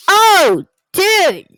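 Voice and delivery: loud, high amazed shout; excited shout. Real, not acted. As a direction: yell it out, thrilled.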